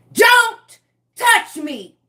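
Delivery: Don't touch me, shouted slow and loud at a stranger; shouted warning. Retold or imitated. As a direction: yell it slow, every word spaced. Repeating warning, louder and slower.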